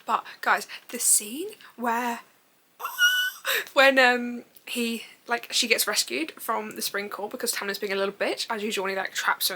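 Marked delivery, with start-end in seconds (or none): 2.8-3.7 high, drawn gasp; overcome, high-pitched intake of breath; emotional gasp. Real, not acted. Telling viewers about the favourite scene, emotional gasp. Recalling a moving book scene.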